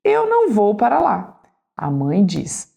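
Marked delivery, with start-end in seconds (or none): 0.0-1.4 furious daughter. Acted. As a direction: dig in like a furious teen. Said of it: "A filha, furiosa".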